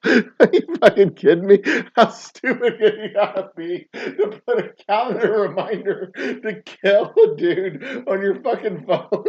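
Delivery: high, laughing disbelief; cracking up at a dumb criminal. Real, not acted. Laughing at the suspect's calendar reminder, disbelieving.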